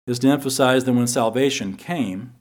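ordinary delivery

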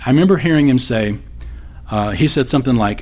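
ordinary talk

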